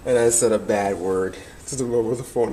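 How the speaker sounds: ordinary talk, nothing marked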